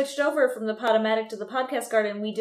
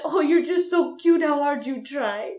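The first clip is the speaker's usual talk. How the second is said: amused French tutor; 3 semitones higher, 4 dB louder than usual. Retold or imitated. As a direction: imitate a tutor laughing at a student's effort. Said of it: "and she laughed. She said".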